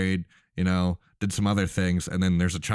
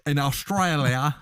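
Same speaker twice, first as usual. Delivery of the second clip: mock Australian accent, pitched up; improvised higher-pitched Australian accent; 8 semitones higher than usual. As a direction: put on a playful Aussie twang. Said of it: "that's actually a good Australian accent".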